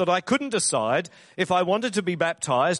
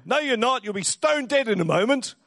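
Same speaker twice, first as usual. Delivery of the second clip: lightly acted, pitched-up retort; mildly mock-scold, higher than usual; dismissive son; 7 semitones higher than usual. Son contradicting his elderly father, lightly acted dismissal.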